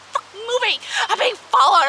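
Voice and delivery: high, loud frightened cry; panicked yell. Retold or imitated. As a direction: shout for help down the phone. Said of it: "I'm freaking out".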